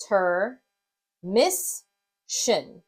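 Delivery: "ter, miss, shin" with a pause after each; syllable by syllable. Shown as a demonstration. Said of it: "break ... into its syllables".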